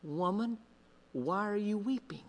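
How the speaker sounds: soft, quiet and tender question; gentle Jesus. Acted. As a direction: ask it softly, as Jesus comforting her. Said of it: "and he says".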